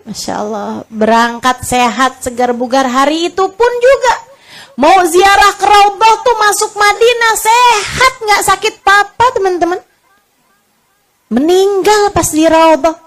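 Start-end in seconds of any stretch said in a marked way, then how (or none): 2.9-12.9 astonished. Real, not acted. Stresses the shock of sudden death.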